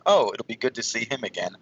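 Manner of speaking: normal talking voice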